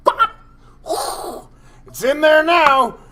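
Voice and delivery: slow, loud and high, crowing it out; triumphant shout. Retold or imitated. Telling his buddies about the bedroom, a loud slow triumphant shout. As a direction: bellow it like a proud victory cry. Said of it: "and I went".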